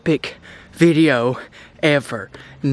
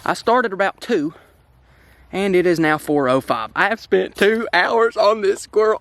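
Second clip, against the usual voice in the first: ordinary talk, nothing marked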